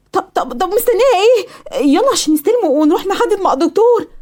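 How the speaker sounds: high-pitched excited rush of enthusiasm; bursting to get going; eager urging. Acted. Daughter urging her mother to book the operation, eager.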